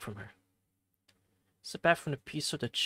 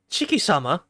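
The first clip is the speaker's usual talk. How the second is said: surprised call; 3 semitones higher, 8 dB louder than usual. Acted. Maid calls out in surprise.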